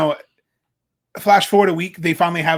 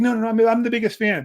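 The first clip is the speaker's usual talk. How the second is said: eager fan; 6 semitones higher, 4 dB louder, faster than usual. Retold or imitated. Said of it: "She's like".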